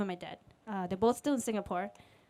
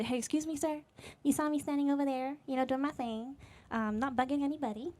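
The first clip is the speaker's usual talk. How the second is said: put-on light southern accent, higher pitch; 4 semitones higher, 4 dB quieter than usual. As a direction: politely complain with a slight southern lilt. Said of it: "I'm talking in a southern accent".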